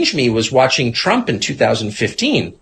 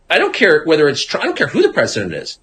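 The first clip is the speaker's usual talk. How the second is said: usual speaking voice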